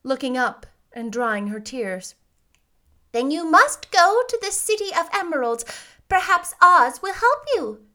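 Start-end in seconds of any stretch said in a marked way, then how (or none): 3.1-7.9 put-on high, emphatic old lady voice; kindly old witch. A narrator's reading. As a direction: advise the child firmly, pitched up.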